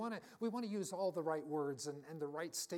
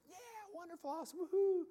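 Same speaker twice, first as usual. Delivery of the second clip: much higher, sing-song fake enthusiasm; mock cheering; 14 semitones higher, 4 dB louder, faster than usual. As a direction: ham up a cheesy cheer.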